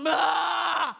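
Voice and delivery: high shouted "bah"; possessed roar. Acted. Possessed person tries to scare those ignoring them, roar. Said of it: "Lagi kesurupan".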